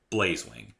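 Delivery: usual speaking voice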